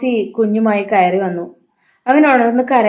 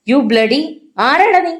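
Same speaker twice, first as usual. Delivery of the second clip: furious outburst, higher and louder at the end; exploding in anger; 6 semitones higher, 4 dB louder than usual. A narrator's reading. Hari explodes at the threatening caller, furious shout. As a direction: blow up at the caller, spitting the question. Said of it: "Hariprasad പൊട്ടിത്തെറിച്ചു".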